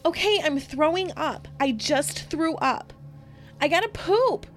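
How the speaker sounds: high, loud panicked pleading; Candace screaming. Retold or imitated. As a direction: beg in panic as if you're suffocating. Retold child pleads.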